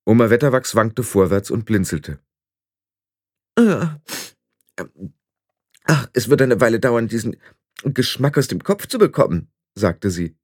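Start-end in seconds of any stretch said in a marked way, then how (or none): 3.6-9.5 put-on old witch collecting herself; Granny, groggy and grumbling. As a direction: grumble it haltingly, shaking off a bad taste.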